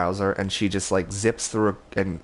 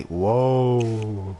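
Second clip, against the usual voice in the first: drawn-out woozy whoa; sway as you say it; dizzy drunk whoa. Retold or imitated. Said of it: "a little dizzy".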